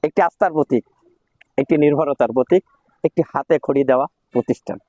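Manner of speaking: ordinary talk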